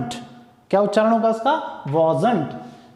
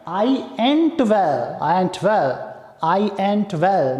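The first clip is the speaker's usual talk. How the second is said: slow drilled example; much slower than usual. Shown as a demonstration. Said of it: "या फिर एक तरीका होता है".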